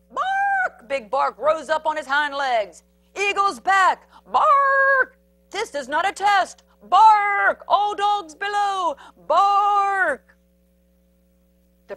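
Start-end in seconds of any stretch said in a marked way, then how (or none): 0.1-0.9 very high, loud bark; high, punched-out bark; barked word. A narrator's reading. Watchdog's bark introduced. 3.1-10.4 high, loud barking warning shout; loud emergency announcer dog; watchdog alarm. A narrator's reading.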